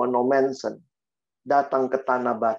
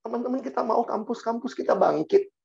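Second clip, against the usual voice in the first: high preacher's call growing louder; rousing exhortation; 11 semitones higher, 4 dB louder than usual. Acted. Preacher challenging friends about their campuses, rousing exhortation. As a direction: challenge the listeners to rise up.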